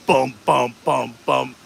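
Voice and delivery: low, evenly spaced bass thumps; DJ drop beat. Shown as a demonstration. Demonstrating a Disney DJ set drop, low vocal thumps. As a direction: mouth four punchy beats of the drop. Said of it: "drop where it's like".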